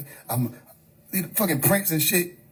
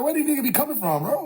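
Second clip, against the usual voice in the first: high, quick incredulous question; fast, bewildered comic protest; baffled outburst; 6 semitones higher, faster than usual. Real, not acted. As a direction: blurt it out in pitched-up disbelief. Baffled outburst, then repeated lower.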